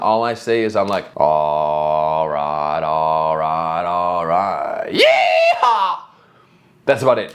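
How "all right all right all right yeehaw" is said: bad American accent. Shown as a demonstration. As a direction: drawl slowly in a mock American accent, then whoop. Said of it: "I can't do an American accent".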